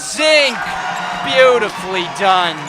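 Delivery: very high, loud shout on the play; excited shout. Real, not acted. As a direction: erupt at the backdoor layup. Live call of a score.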